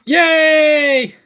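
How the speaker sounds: high, loud cheer. Real, not acted. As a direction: shout it with glee.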